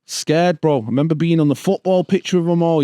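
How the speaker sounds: ordinary delivery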